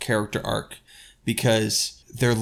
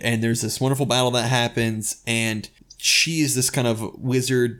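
ordinary talk

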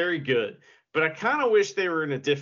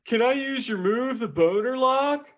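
stoner impression; 9 semitones higher, 6 dB louder than usual. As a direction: play a dopey stoned guy. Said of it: "That's what he sounds like".

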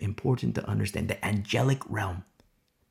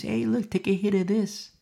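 seductive tempter; 6 semitones higher than usual. Retold or imitated. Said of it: "they're gonna seduce".

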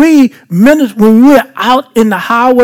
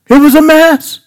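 emphatic repeat; 7 semitones higher than usual. Shown as a demonstration. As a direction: say it again, pitched up for emphasis.

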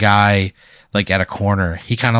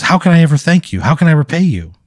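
tearful gratitude; 7 semitones higher, 4 dB louder, faster than usual. Acted. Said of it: "He's got tears streaming down his face".

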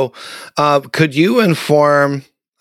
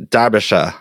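Boston accent; 4 semitones lower than usual. Shown as a demonstration. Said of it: "my Bostonian would say".

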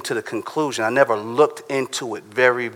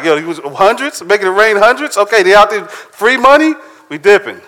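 rising higher and louder to a squeal at free money; building excitement; 8 semitones higher, 5 dB louder than usual. Retold or imitated. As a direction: get more and more thrilled, peaking on free money.

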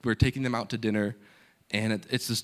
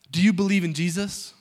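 higher-pitched, forthright question; bold; 6 semitones higher, 4 dB louder than usual. Retold or imitated. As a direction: put it boldly, pitch lifted. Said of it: "Very boldly".

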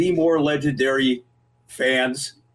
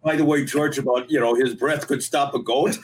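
ordinary talk, nothing marked

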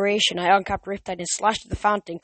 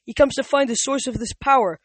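fearful; 6 semitones higher, 6 dB louder than usual. A narrator's reading. Theseus warns in fear.

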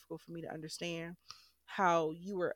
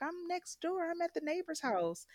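casual reply; 12 semitones higher than usual. Retold or imitated. Retelling her reply to sister.

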